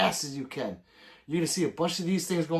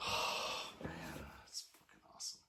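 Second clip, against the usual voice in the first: hushed awed whisper; whispered awe; 13 semitones lower, 15 dB quieter than usual. Real, not acted. Awed whisper about the cannon. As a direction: breathe it out in awe.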